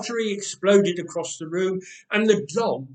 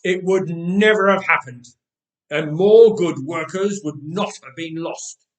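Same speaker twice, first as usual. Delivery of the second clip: indignant; 8 dB louder than usual. A narrator's reading. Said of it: "she continued".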